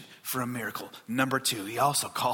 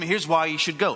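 ordinary delivery

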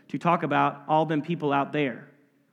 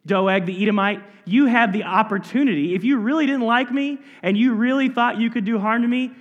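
louder, higher, heated taunt at Doeg; taunting challenge; 4 semitones higher, 4 dB louder than usual. Acted. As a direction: throw the challenge in his face, loud and rising. Preacher voices David taunting Doeg.

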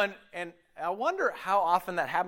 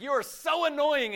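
annoyed whine; 11 semitones higher than usual. Retold or imitated. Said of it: "I'm just like".

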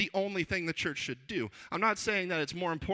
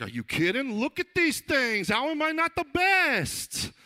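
loud, rising brag, stretched out; boastful Simon Peter; 10 semitones higher, 6 dB louder, slower than usual. Retold or imitated. Disciple arguing he is the greatest, loud hammed-up boasting. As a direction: show off with mock outrage, climbing higher and louder. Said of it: "I'm sure Simon Peter's over there".

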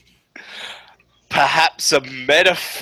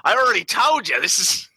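high-pitched Australian comic voice; Dick Darlington; 7 semitones higher than usual. Shown as a demonstration. Bar man repeating who is on the phone, Australian accent. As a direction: insist in a put-on Australian accent, pitched well up. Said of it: "Dick Darlington Australian voice".

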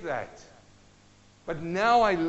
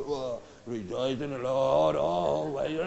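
slow, low, grudging drawl; mock reluctant rejoicing; 6 semitones lower, slower than usual. Mocking a gloomy believer rejoicing grudgingly, slow and low. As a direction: say it like you don't mean it. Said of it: "How can you rejoice from that stance?".

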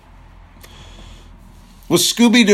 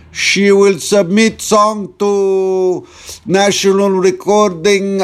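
mock Yugoslavian accent; 4 semitones higher than usual. Host voicing an immigrant father's dream in mock Yugoslavian accent. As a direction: ham up a Yugoslavian dad's accent. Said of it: "rip on his accent".

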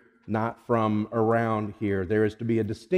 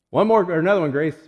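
usual speaking voice

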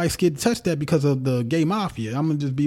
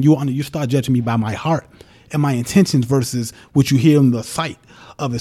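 ordinary talk, nothing marked

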